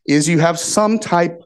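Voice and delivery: ordinary talk, nothing marked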